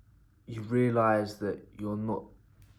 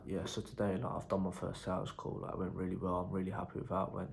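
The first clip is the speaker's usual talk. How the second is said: imitating an emotionless past self, softer; one-dimensional, no emotion; 4 dB quieter than usual. Salesman mimicking his old video after a first sales call, emotionless. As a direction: say it softly with all feeling drained out. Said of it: "one-dimensional, no emotion. I was like".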